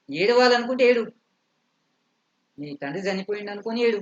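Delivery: quick, mock-angry mother-in-law; acted scolding. Mother-in-law scolding her weeping daughter-in-law.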